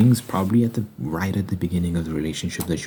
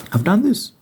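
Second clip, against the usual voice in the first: higher, louder burst; excited; 7 dB louder than usual. Real, not acted. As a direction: blurt it out excitedly. Bursts into personal story.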